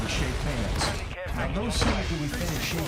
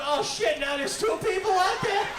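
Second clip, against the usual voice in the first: mock panic; 12 semitones higher than usual. Occupant panics at hearing two people waiting, high mock yelp. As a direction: yelp it high like you've just been cornered. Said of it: "Now they're in there going".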